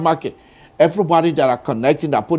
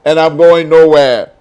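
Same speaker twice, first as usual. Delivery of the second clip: defiant; 3 semitones higher, 8 dB louder than usual. Real, not acted. Host defiant declaration.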